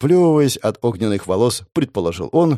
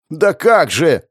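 sharp outcry of protest; shouted; 5 semitones higher, 5 dB louder, slower than usual. A narrator's reading. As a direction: shout it in dismay. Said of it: "выкрикнул ратник". (выкрик)

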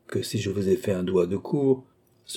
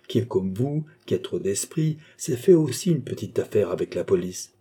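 plain reading voice

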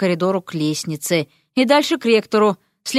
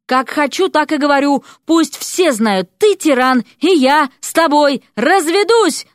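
loud, high, furious outburst building to the end; angry yell, last words punched and stretched; 6 semitones higher, 4 dB louder than usual. A narrator's reading. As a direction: shout it so everyone hears. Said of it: "заорала Вася".

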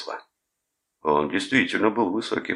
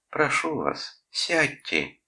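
high, put-on polite request; dainty, well-mannered plea; 11 semitones higher, much slower than usual. A narrator's reading. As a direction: ask very courteously in a high voice. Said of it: "вежливо сказал Клод".

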